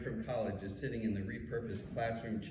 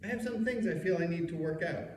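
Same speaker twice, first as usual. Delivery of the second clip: casual; 8 semitones higher than usual. Retold or imitated. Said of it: "I say casually".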